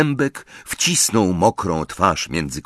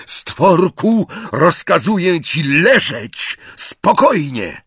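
louder, higher shouted order; roaring command; 10 semitones higher, 5 dB louder, slower than usual. A narrator's reading. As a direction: bellow over the noise to pin him. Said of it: "Harry ryknął jeszcze głośniej".

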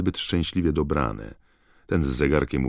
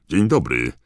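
higher, louder jaunty greeting; brisk, hearty; 3 semitones higher, 8 dB louder than usual. A narrator's reading. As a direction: greet a stranger briskly and loudly. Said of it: "zagadnął go dziarsko pan Weasley". (dziarskie, głośne powitanie)

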